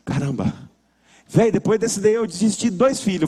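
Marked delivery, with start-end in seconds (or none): 0.0-0.5 low, hushed mock amazement; stunned relief. Worn-out uncle once the baby finally sleeps, low stunned murmur. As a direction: drop low, as if drained. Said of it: "E você fica assim".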